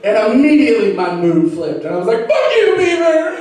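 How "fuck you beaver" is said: mock jilted rant. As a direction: scold the beaver like a jilted lover. Mock breakup rant at beaver.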